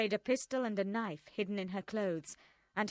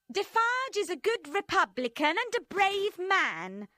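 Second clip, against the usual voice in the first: high, loud hard revolutionary woman; Madame Defarge voiced; 10 semitones higher, 6 dB louder than usual. A narrator's reading. Madame Defarge praising her husband to friends, high and hard. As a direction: play her cold and proud.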